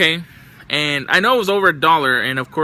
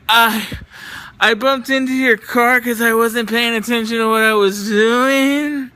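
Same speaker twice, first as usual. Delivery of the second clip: higher, dazed voice climbing up at the end; imitating a spaced-out woman; 6 semitones higher, 3 dB louder than usual. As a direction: play the stumbling stranger confessing the bump. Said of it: "And she said it like that too".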